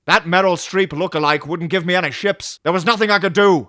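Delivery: loud, defensive retort; hammed-up protest in a quarrel; defensive captain. Acted Picard and Raffi quarrel.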